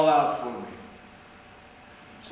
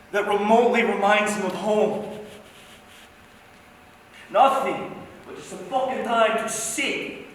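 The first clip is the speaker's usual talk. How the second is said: ordinary talk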